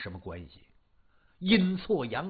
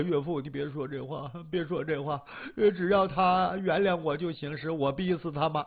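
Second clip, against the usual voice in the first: subdued weepy son-in-law; tearful pleading. Acted.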